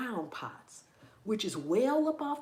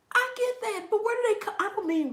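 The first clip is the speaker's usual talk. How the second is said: incredulous; 13 semitones higher, 5 dB louder than usual. Real, not acted. Baffled about worms.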